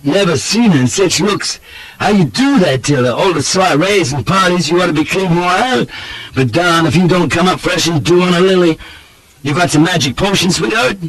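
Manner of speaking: gushing Southern roué, pitched very high; high, effusive courting voice; gallant flattery. A narrator's reading. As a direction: lay the compliments on thick. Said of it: "cries the other roue gallantly".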